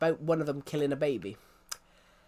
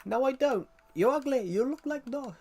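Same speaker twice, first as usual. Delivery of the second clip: higher, put-on Russian schoolgirl accent; mock Russian insult; 9 semitones higher than usual. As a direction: squeal back the insult in broken Russian English. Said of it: "suddenly it was".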